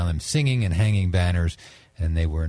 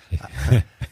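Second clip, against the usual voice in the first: laugh; 3 semitones lower than usual. Real, not acted. Laughs after the joke.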